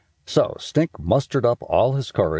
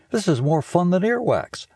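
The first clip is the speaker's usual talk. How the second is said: put-on a higher, eager boy's voice; excited kid Stink; 11 semitones higher than usual. A narrator's reading. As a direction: gush like a thrilled kid. Stink answers Missus D.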